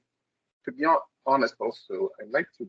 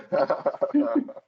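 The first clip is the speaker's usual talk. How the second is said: laugh; 6 semitones higher, 6 dB louder than usual. Real, not acted. Amused reaction to coincidence.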